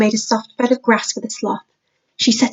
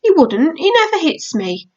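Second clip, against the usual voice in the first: lightly voiced little boy, higher; a small boy protesting to his sister; young boy Max; 6 semitones higher than usual. Little brother telling his sister, higher child voice.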